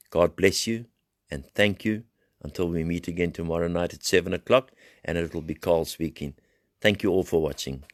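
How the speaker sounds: usual speaking voice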